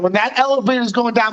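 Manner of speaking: normal talking voice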